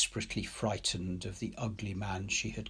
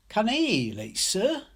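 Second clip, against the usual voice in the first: put-on high, eager child's voice, a bit louder; hopeful little girl; 11 semitones higher, 5 dB louder than usual. A narrator's reading. Girl asking Ryder if she can heal the bird, high and eager. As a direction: ask hopefully, like a child wanting to help.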